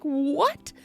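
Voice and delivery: stunned rising what; shocked Juliet. Retold or imitated. Juliet hearing she must marry Paris, stunned question. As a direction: gasp it out in disbelief.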